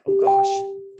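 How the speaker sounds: high, loud "oh gosh" as it sinks in; a sudden high, loud cringe of alarm; startled gasp. Retold or imitated. Telling the coaching group how she reacted to the mom, in a startled high yelp. Realizing mom dislikes Beachbody.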